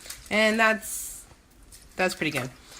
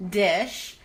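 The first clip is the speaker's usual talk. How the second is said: usual speaking voice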